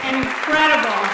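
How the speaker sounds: a loud, high burst; emphatic. Real, not acted. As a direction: really punch incredible. Celebrating fiftieth anniversary.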